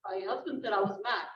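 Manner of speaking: ordinary talk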